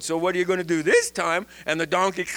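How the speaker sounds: usual speaking voice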